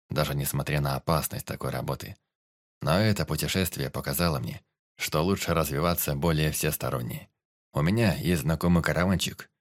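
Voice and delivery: low warrior voice; warrior Тирот, earnest. Acted.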